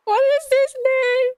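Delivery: high, loud, cracking-up question; laughing disbelief. Real, not acted. Streamer discovers the tree's Latin name, laughing in disbelief. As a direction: squeal it through laughter.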